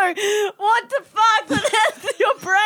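high, helpless laughing cry; laughing shriek. Real, not acted. Co-host appalled at friend's idea, shrieking with laughter. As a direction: squeal it through real laughter.

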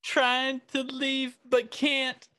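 very high, slow words through laughter; laughing high. Real, not acted. Laughing at the prank image, high squeaky giggle. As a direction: squeal it out while cracking up. Narrating the stuck parent.

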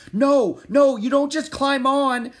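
high, very loud alarmed cry; panicked shout. Acted. Jack sees Annie climbing onto the horse, panicked shout. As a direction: yell it in panic to stop her. Boy stops girl mounting horse.

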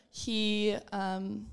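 ordinary talk

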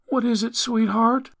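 high-pitched, sweet woman's voice; coaxing mother. A narrator's reading. Mother answers Ellie's question, high sweet coaxing.

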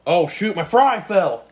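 real startled yelp; sudden loud dismay, slowed; surprised. Real, not acted. Fry drops mid-talk.

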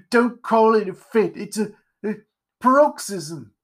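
high, faltering character voice; indignant Pumpkinhead. Acted. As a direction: protest indignantly, groping for the word. Pumpkinhead objects to the word fit.

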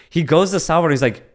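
usual speaking voice

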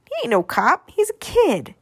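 put-on scoffing little boy, pitch jumping up at the end; mildly mocking child, rising high; snickering kid. A narrator's reading. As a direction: dismiss it with a kid's scorn. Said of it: "Piper snickered".

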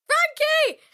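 very high-pitched call; high mock yoo-hoo call; acted calling out. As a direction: squeal the name as a call.

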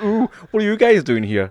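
high, slow camp voice; camp moose. Pretend. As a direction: sing it out as a flamboyant moose. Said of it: "It's a very camp moose".

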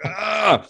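short high yelp of pain; a hammed-up ouch of a sore knee; mock pain cry. A veteran mocking his sore knee, a high yelp. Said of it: "and I'm like".

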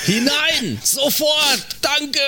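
high excited goal shout; screaming goal commentary. Retold or imitated. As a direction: yell it like a stadium announcer. Mock goal call.

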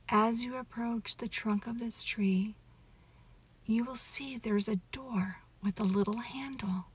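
plain reading voice

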